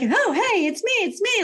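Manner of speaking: high, bouncy dog voice; happy dog voice. Retold or imitated. Stray dog greeting her, high and carefree.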